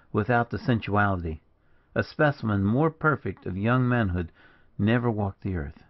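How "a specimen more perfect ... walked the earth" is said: ordinary delivery, nothing marked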